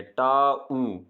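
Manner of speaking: टा ऊ drawn apart as taa, oo; two separate sounds. Shown as a demonstration. Teacher breaking town into its vowels, two separate sounds. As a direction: stretch टा, then give ऊ separately. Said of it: "इसमें दो आवाज़ें आ रही हैं".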